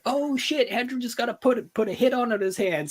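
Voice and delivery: high, startled mock panic; mock alarm. Narrator retells overhearing the hit, high startled panic. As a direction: squeak it out like you just overheard trouble.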